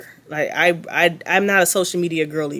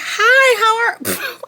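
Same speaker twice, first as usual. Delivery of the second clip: much higher, louder girly mock voice; mocking Brittany; 4 dB louder, much slower than usual. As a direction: ham up a sugary fake-sweet girl. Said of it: "I cannot stop mocking this girl".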